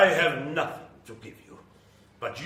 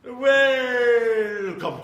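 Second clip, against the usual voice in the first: grand welcome; 10 semitones higher, 9 dB louder than usual. Acted. Dracula greeting.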